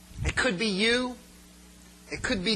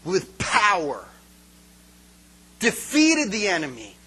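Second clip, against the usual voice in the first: slow, weighty, louder preacher build; slow dramatic build; 6 dB louder, slower than usual. Acted. Pastor building toward Christ's return, slow and weighty. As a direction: drag each phrase out for weight.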